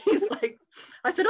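laughing. Real, not acted. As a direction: laugh as you tell it. Retelling God laughing at her.

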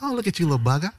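high, laughing Australian Steve Irwin voice; Australian wildlife host cooing at an angry animal; Steve Irwin impression. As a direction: coo at a little critter like Steve Irwin. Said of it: "only if it's Steve Irwin".

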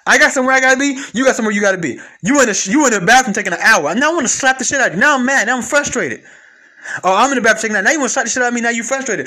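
loud, high exasperated rant; acted frustration. Man ranting at his partner who takes an hour in the bathroom, loud and high. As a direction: rant like you're fed up. Acting out bathroom time fight.

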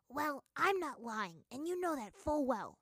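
loud, slow, high comeback; sharp retort. Acted. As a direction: snap it back, slow and loud. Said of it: "Smellerbee retorted".

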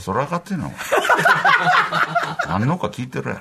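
laughing through a tough-guy line; mock menace cracking into laughter; laughing mock threat. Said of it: "って言われんで".

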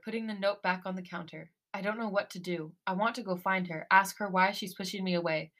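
ordinary delivery

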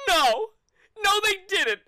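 put-on high wailing child voice; panicked crying kid. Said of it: "the kid goes".

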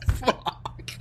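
normal talking voice

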